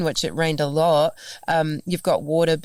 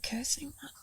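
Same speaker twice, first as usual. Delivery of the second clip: high, hushed whispered cursing; whispered disbelief; 9 semitones higher, 14 dB quieter than usual. Retold or imitated. Shocked by the reader's complaint, a whispered cursing. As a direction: breathe it in shock.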